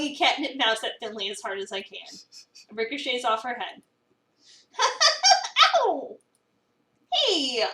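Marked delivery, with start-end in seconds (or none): 4.8-5.7 high, loud squealing laugh. Acted. Finley laughs after the mouse hits her, a high loud squeal. 5.8-7.6 mock ow. Hit by flung catnip mouse.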